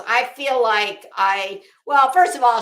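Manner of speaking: ordinary talk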